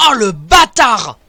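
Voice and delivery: loud, high indignant cry; furious outburst. Real, not acted. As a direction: shout in outrage at the enemy. Enemy ambush in game.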